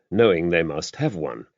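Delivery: plain reading voice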